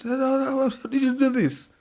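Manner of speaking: high-pitched incredulous question; retold amazement. Friend asks how he pulled off the passes, amazed. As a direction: imitate an amazed friend, voice jumping up.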